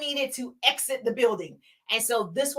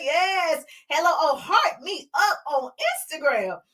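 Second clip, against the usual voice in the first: normal talking voice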